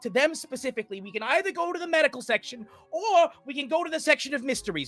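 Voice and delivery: high, fast talking guide; hurried Emmeline. Acted. Emmeline offers routes while sprinting, high and fast. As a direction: rush out the options while running.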